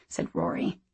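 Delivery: plain reading voice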